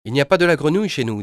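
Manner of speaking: brisk correction from the line; company voice. Acted. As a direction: operator setting the caller straight. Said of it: "dit la voix".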